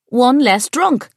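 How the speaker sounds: high, loud, slow punchline; boy shouts his joke's punchline at a wake, loud and high; exclaimed punchline. A narrator's reading. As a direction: belt out the joke's payoff. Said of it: "Kieran exclaimed".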